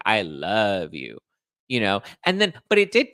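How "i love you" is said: imitating a slow, low declaration of love; slow, heartfelt mock confession; lovestruck. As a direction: draw out LOVE as if deeply meant. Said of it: "And to her, she's like".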